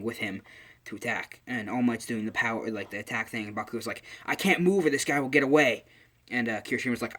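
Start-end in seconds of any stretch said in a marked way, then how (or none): 4.3-5.8 loud, higher call to teammates; acted urgent shout. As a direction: call out mid-fight, play-acting. Bakugo calls out while pinning villain.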